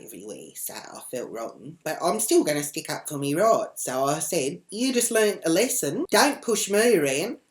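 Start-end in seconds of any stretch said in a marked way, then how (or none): 4.7-7.4 loud, high re-enacted lecture; defiant warning. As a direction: lay down the law, loud and pitched up. Teller lecturing woman after fight.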